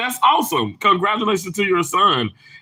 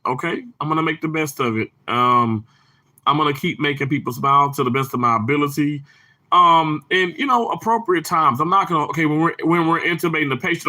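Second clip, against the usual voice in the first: ordinary talk, nothing marked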